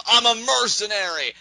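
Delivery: loud, high-pitched wrestler outburst; imitated scream. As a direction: yell it at a rival, hammed up. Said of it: "screams at him".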